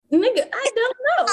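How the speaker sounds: high, exasperated mock reply; exasperated retort. Retelling snapping at her boyfriend about his dog, exasperated. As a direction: toss it back at him, fed up.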